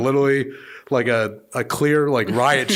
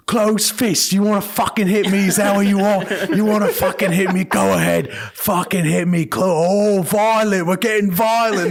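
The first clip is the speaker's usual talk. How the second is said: higher and louder, hammed-up aggressive taunt; mock angry shout; 8 semitones higher, 4 dB louder than usual. Old traveler yelling at the bailiff to hit him, mock angry shout. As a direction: goad him to throw a punch, loudly.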